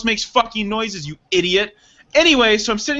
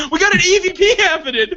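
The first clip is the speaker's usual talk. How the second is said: high, excited-sounding call; mock ghost hunter; 11 semitones higher, 4 dB louder, faster than usual. Mimicking the ghost hunter mishearing the commotion, mock excited. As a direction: announce the find in a raised voice.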